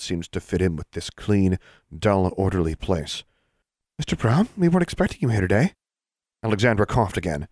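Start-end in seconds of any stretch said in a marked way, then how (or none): 4.0-5.7 higher-pitched, quick witch clerk; surprised witch clerk. A narrator's reading. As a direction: greet a colleague you didn't expect. Witch greets disguised Alexandra as Mr Brown.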